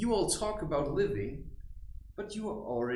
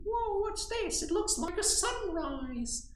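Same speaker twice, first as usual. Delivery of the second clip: high, slow wondering gasp; mock amazement; slower than usual. Imitating someone struck by a sunrise at the computer, high and awed. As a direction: marvel like seeing a sunrise. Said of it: "and then suddenly".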